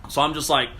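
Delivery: ordinary talk, nothing marked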